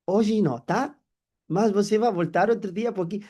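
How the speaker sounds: customer brushing off. Retold or imitated. Customer declining after browsing.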